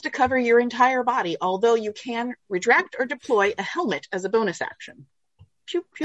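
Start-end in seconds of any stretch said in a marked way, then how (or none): 5.6-6.0 high, soft pew pew; laser sound effects. Pretend. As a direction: make blaster noises. Imitating armor blaster sounds.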